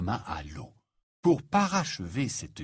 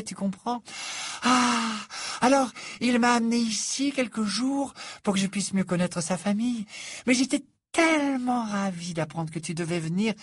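high-pitched, breathless enthusiasm; high, exclamatory Fleur voice; gushing young Frenchwoman; 10 semitones higher than usual. Acted. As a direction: chatter on effusively, airy and self-satisfied. Fleur's character voice.

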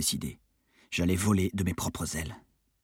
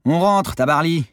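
raised, high insistent call; 9 semitones higher, 7 dB louder than usual. A narrator's reading. Sailor to half-asleep crewmate, insistent. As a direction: press a dozy friend to get moving.

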